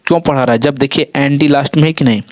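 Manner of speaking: ordinary talk, nothing marked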